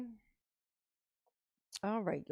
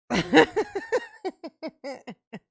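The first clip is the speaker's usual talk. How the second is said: high, loud burst of laughter tailing off; real cackle, breaking out loud then fading; laughing; 5 semitones higher, 6 dB louder than usual. Real, not acted. After saying psych, no, laughing.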